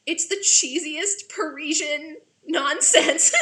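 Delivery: high, loud, drawn-out slow laughing verdict; laughing delight. Real, not acted. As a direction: stretch it out as you crack up. Laughing over the actor's accent.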